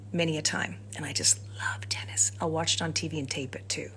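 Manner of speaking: hushed confiding whisper. Real, not acted. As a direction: whisper it like a secret. Confiding love of tennis.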